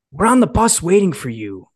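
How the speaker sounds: loud, high, bossy order; imitated angry boss. Boss on the phone ordering him down, loud. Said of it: "That's how he was talking to me".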